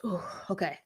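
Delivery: ordinary delivery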